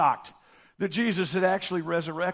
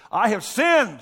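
louder, higher cry of guilt; anguished confession; 5 semitones higher, 8 dB louder, slower than usual. Retold or imitated. Judas confessing to the priests, louder and higher. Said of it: "he says".

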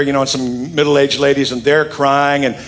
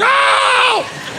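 mock old lady wail. Pastor mimics the little old lady crying out in church. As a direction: let out a high old-lady shriek. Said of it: "The little old lady's like".

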